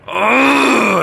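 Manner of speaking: loud, high fed-up groan; exasperated groan. Real, not acted. Speaker wishing the voices gone, loud exasperated groan.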